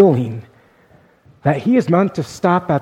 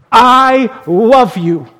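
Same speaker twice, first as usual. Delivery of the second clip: higher, louder and slow, every word drawn out; screamed I love you; 7 semitones higher, 6 dB louder, much slower than usual. Retold or imitated. God telling him to shout love at the store, a slow loud cry. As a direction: belt it out slowly like a command from above. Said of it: "to scream on the top of your lungs".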